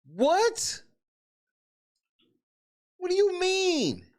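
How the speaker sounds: high, stunned "what?" that is pulled out slowly; can't believe what he just heard; stunned disbelief. Real, not acted. Reacts to the clip in disbelief.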